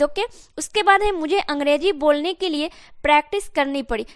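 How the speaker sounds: normal talking voice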